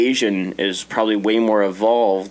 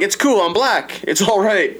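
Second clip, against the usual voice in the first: playful reassurance; 11 semitones higher, slower than usual. Retold or imitated. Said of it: "I'm like".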